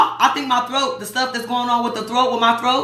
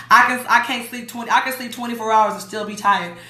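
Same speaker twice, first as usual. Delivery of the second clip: ordinary delivery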